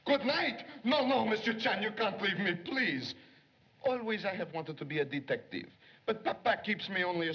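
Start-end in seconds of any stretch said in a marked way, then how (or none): 0.0-3.1 don't let him walk out on you; pleading. Acted. Secretary begging Chan to stay, pleading.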